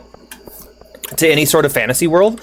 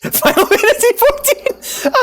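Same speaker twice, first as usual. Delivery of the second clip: laughing; slower than usual. Real, not acted. Laughing burst at chat suggestion.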